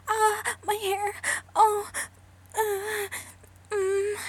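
sharp loud gasps and cries of pain; loud pained whimpering. Acted. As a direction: cry out as your hair is yanked. Gasping in pain as hair is pulled.

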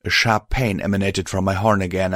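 plain reading voice

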